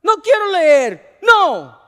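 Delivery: high, loud, slow whiny refusal; boy refusing his teacher, whining; child's refusal. Acted. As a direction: dig in like a stubborn kid.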